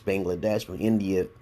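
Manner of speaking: ordinary delivery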